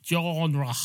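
"genre" with a throaty French r at the end; French pronunciation. Shown as a demonstration. Cat trying out a French word, mock-French pronunciation of "genre". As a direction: say "genre" in hammed-up French, open final vowel. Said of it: "supposed to pronounce it French".

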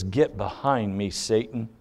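low, quiet, blunt command; blunt rebuke. Retold or imitated. Jesus rebuking Peter, very bluntly. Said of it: "speaks to him very bluntly and says".

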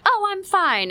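put-on high, loud little-boy chirp; a mom picturing her son instantly fine; cheery child voice. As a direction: bounce it like a kid suddenly okay.

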